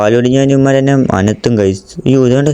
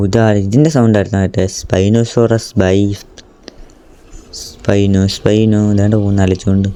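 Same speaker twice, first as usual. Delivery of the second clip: ordinary delivery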